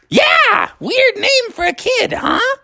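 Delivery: put-on very high, squawky little frog girl; crazy old-person voice. A narrator's reading. Girl frog introduces herself to Froggy, crazy old-person voice. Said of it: "you still talk in that s-, crazy voice".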